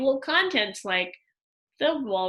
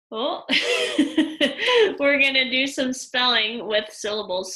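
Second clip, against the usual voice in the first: real laughing; 3 semitones higher, 6 dB louder than usual. Real, not acted. Teacher laughing after a cough interrupts the spelling lesson, laughing. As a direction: laugh breaking out, then talk through it.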